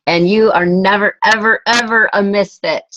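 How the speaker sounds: insisting she is never a misfit; emphatic never ever. Real, not acted. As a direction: lean hard into each never to reassure her. Team leader reassuring a member.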